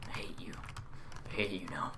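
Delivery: soft breathy whisper; barely voiced aside. Real, not acted. Whispering during gameplay.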